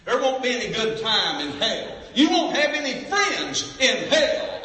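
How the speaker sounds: high, loud, slow preacher's cry; preaching at full force. Acted. Preacher warning the congregation, loud high fervour. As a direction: hammer each denial.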